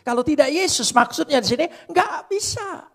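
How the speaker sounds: loud, high preaching voice; fiery preaching. Acted. Preacher exhorting the church to bear insults, at full force. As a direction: drive it home from the pulpit.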